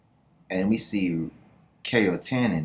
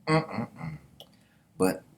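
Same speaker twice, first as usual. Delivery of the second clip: ordinary talk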